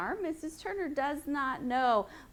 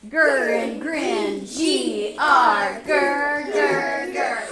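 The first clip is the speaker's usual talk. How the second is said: loud growling bear; 6 dB louder, much slower than usual. Shown as a demonstration. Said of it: "Be a bear".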